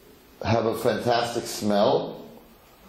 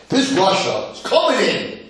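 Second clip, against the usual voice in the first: high, heated storytelling; angry; 12 semitones higher, 4 dB louder than usual. Acted. Teacher introducing the angry wicked son, acted anger. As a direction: announce his angry arrival in a raised, worked-up voice. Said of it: "He's angry".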